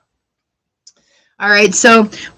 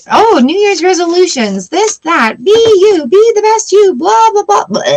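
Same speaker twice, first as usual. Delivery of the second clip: much higher mocking sing-song of ads; mock cheerful advert voice; 10 semitones higher than usual. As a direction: parody the chirpy resolution hype. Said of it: "TV or radio".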